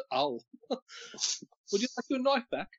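laughing through the words, high and slow. Real, not acted. Brother laughs back at the jab, slow and laughing. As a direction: chuckle out a slow teasing offer.

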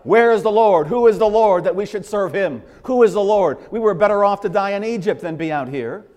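loud, high outcry of a complaining crowd; preacher voicing the grumbling people loudly; mock crying out. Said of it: "As they're crying out".

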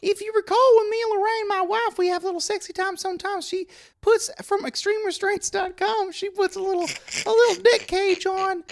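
high-pitched awkward store guy; squeaky oddball coworker voice; high character voice. Acted. Clint character continues.

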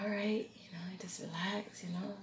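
whispered, soothing calm; hushed reassurance. Retold or imitated. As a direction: gently hush a panicking sibling. Voices sister calming her.

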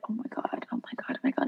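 retold horrified whisper under the breath; panicked whisper. Server reliving a drinks spill on the camera, panicked whisper. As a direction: whisper it very softly, imitating your own dawning panic.

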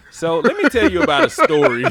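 cracking up while talking; loud, laugh-filled setup of a story. Real, not acted. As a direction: try to start the story through laughter. Breaks up introducing own story.

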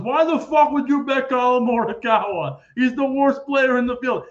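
mock outrage at a bad bet; retold incredulous rant; incredulous outburst. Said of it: "And he goes".

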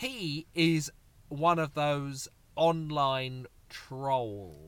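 very slow, hammed-up posh drawl. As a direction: drag every word like a toff. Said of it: "you have to go posh to say".

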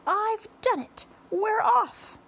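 very high, loud whoop; triumphant shout. A narrator's reading. Centipede through the ceiling hole to his friends, triumphant shout. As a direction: shout the good news down to everyone. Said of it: "and shouted".

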